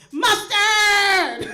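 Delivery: high, loud yelled MUSTARD. Acted. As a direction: shout the word out wildly. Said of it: "I'd yell a condiment".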